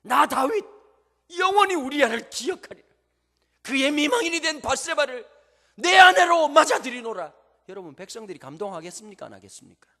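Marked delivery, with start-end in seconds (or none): 0.0-7.3 loud, high, grand king's decree; royal proclamation. Acted. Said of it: "더 멋진 공약을 발표하는 거예요". 9.3-9.9 hushed rhetorical whisper. Real, not acted. Rhetorical aside to congregation.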